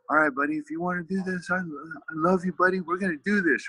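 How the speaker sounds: retold emotional vow, pitched high; tearfully emotional. Dad hugging his son tight, tearfully emotional. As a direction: voice lifted high, re-living a tearful promise. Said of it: "tears started rolling down my face".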